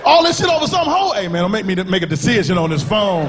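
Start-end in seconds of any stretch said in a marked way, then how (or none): 0.0-1.1 louder, high exasperated yell; outraged yell. Retold or imitated. Man yelling at his boy over a girl, loud. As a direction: blow up at your boy.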